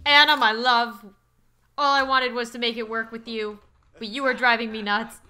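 loud, hammed-up declaration; melodramatic lover. Jilted suitor confronting Anna, loud melodrama. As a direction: declare it loudly to a lost love.

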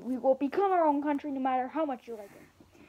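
ordinary delivery

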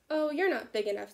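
loud, scoffing dismissal; grouchy ladybug. A narrator's reading. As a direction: brush them off grumpily. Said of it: "said the grouchy ladybug".

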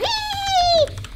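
very high laughing squeal; high thief's giggle; gloating squeal. Acted. Figure who stole the taco, high gloating squeal.

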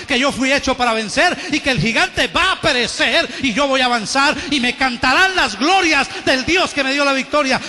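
loud, rising sermon voice pressing each point; fervent preaching. Acted. Pastor preaching on grace and works, loud fervent build. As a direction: hammer the truth home to the church.

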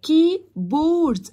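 "كيبورد" slowly broken as key, board; two split syllables. Shown as a demonstration. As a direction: separate both halves of "كيبورد". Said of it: "بصوا متقسمة مقطعين". ("كيبورد" مقطعين كي ثم بورد)

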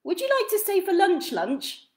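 inviting twin. Acted. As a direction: offer lunch cheerfully. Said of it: "asked one of the twins".

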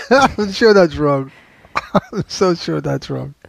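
loud protest through laughter; laughing disbelief. Real, not acted. Host doubts co-host's word, laughing. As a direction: doubt it while cracking up. Cracking up over co-host's pronunciation.

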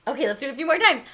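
plain reading voice

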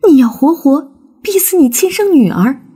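anguished cry. A narrator's reading. Daughter defies father in quarrel.

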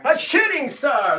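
high, loud delight; excited. Real, not acted. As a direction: exclaim it with joy. Reacts to donation.

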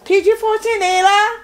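high, loud startled question; surprised check. Real, not acted. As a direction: question her daughter sharply. Mom questioning daughter's show.

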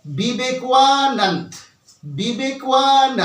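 বিবেকানন্দ drawn out very slowly with a wa glide; slow correct demo. Shown as a demonstration. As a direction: stretch বিবেকানন্দ syllable by syllable. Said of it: "কিভাবে বলতে হবে আমি দেখাচ্ছি". (খুব ধীরে, ওয়া দিয়ে বিবেকানন্দ)

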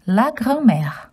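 la grand-mère said in French, r in the throat; French pronunciation. Shown as a demonstration. Teaching children the word la grand-mère in French. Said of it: "Granny in French is".

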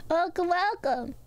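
high, slow mimicked welcome; high impression of her welcome; imitating her greeting. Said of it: "That is exactly how she says it".

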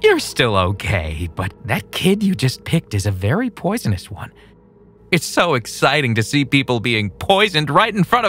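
savoring cruelty; excited psycho voice. Acted. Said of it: "Kevin said".

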